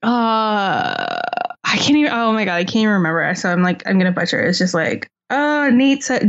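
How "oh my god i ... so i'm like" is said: ordinary talk, nothing marked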